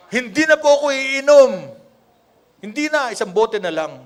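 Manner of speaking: imitating a sheepish drinker promising God; a loud, whiny mock promise to quit; mock pleading vow. Preacher mimicking a churchgoer begging off drinking.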